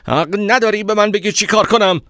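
high, angry shout; loud and angry. A narrator's reading. Narrator angered by the man's commanding tone, shouting angrily. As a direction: yell back, furious at being ordered about. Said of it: "با صدای بلند گفتم".

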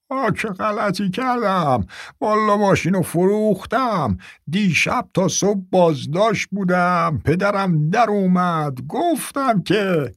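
put-on high, slow complaining old man; whining old man. Old man whines about his arrest.

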